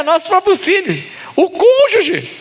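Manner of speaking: emphatic exclamation. Acted. Exclaims about spouses.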